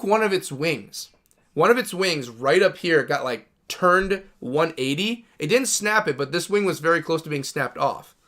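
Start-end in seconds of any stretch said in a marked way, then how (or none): none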